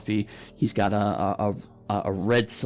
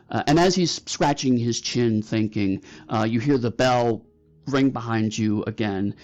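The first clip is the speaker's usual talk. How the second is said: ordinary talk, nothing marked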